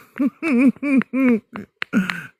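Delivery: long hooting laugh; high laugh. Real, not acted. Laughing about Christmas at the beach, hooting laugh.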